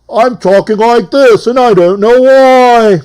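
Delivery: loud, high-pitched TV host bellow; over-the-top shouted celebrity-show host; Robin Leach impersonation. Acted. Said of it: "That's my impersonation of".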